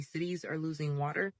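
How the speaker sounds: normal talking voice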